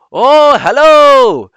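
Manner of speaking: put-on high, very loud, drawn-out Indian-accent greeting. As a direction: sing out a hammed-up Indian accent. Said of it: "I always go with the Indian accent".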